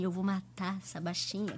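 low, hushed mock threat; imitated murderous look. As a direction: mutter the threat low and soft. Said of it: "uma cara de tipo assim".

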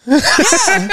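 put-on high, innocent kid yeah. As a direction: answer like a sly child. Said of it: "He will see".

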